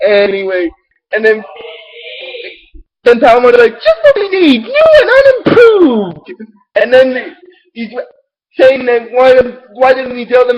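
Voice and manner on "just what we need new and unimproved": much higher sarcastic cartoon voice; deadpan the joke in a high squeaky register; hammed-up high sarcastic quip; Palmon sarcasm.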